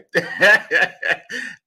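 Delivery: bright high laugh; a real laugh at the joke; burst of laughter. Real, not acted. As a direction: laugh out at yourself. Laughs at own accent.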